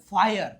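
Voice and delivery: ફાયર loud, with an eye-uh glide; demonstrated vowel glide. Shown as a demonstration. Teacher models fire for students, ફાયર with eye-uh glide. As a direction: model ફાયર clearly, i gliding into a schwa. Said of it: "આઈનો ઉચ્ચાર આઈ એ".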